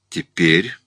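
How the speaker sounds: mildly cryptic; enigmatic tone. A narrator's reading. Madame announces she is ready, enigmatic. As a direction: say it with an unreadable edge. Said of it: "сказала она каким-то непонятным тоном".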